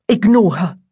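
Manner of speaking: ordinary delivery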